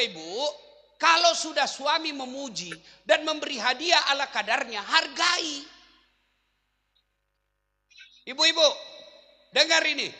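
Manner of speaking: loud, high sermon voice urging the wives; forceful preaching. Acted. Preacher urging the wives in the congregation, forceful preaching. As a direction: preach it at full force to the mothers.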